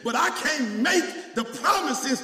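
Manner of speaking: loud, high shouted preaching; preaching at full force. Acted. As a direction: whip the church up, full voice.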